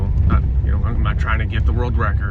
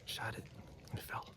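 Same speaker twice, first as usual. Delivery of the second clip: hushed, shaken whisper after the shot; hunter's whisper; 25 dB quieter than usual. Real, not acted. Hunter telling viewers the doe is down, shaking, whispered. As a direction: keep it whispered, rattled by adrenaline.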